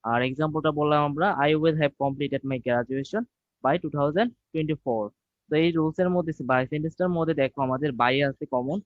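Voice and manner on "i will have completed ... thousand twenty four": slow dictation. Shown as a demonstration. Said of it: "teacher dictates example sentence".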